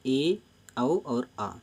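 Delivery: ए, औ और आ each vowel held long and slow; drawn-out vowel demo. Shown as a demonstration. Teacher slowly sounding out vowels ए, औ, आ for students. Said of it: "आप बोल कर देख लीजिए।".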